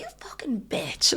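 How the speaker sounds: slow, whispered insult; mock whisper. Comedian retelling her stage jab at a lad, whispered. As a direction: hiss it under the breath, slow.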